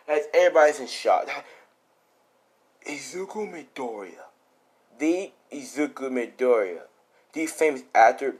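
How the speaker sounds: ordinary delivery, nothing marked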